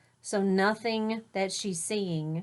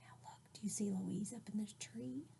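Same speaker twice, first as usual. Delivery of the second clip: whisper; 16 dB quieter, faster than usual. Real, not acted. Whispered question to children.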